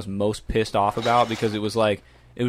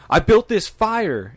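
high, slow taunt; 9 semitones higher, 3 dB louder, much slower than usual. Retold or imitated. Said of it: "he's like".

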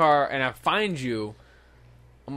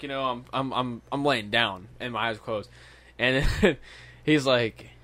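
usual speaking voice

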